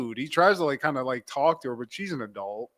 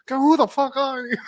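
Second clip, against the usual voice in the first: high put-on voice through a laugh; mock offended; indignant stranger; 9 semitones higher than usual. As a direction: laugh while snapping. Continuing the chick voice.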